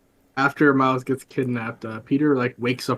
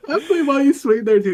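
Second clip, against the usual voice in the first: laughing; 13 semitones higher, faster than usual. Real, not acted. Laughing after funny retelling.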